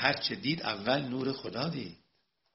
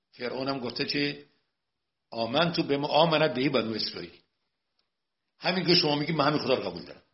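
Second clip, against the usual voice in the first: fiery preaching; 4 semitones higher, 10 dB louder than usual. Acted. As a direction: preach it full force to the hall. Preaching at full force.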